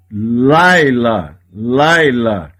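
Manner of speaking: Laila said slowly, each syllable clear, sheva silent; Hebrew word demo. Shown as a demonstration. Teacher shows students how to say Laila, slow and clear. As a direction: pronounce Laila as two syllables, lai-la. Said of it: "Não lê o som do Sheva".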